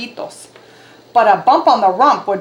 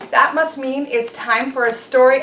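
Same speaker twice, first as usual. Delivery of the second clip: ordinary delivery, nothing marked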